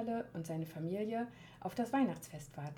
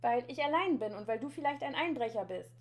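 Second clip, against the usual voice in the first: put-on loud, raised-pitch child's explanation; calling out; 5 semitones higher, 7 dB louder than usual. A narrator's reading. Boy alone explaining to possible burglar, calling out. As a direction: shout the reason through the door. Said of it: "ruft Schnüpperle".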